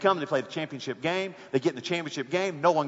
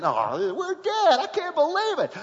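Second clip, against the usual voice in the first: a fan sure the game is lost; mock despair; 10 semitones higher, 3 dB louder, slower than usual. Fan at home after strike two, high, despairing groan. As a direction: pitch jumps high on dead, then a groan of disbelief.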